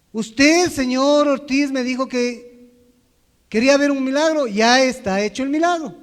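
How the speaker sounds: put-on an old man loudly telling him the miracle is done; loud insistent. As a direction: lay it down firmly like a cranky old man.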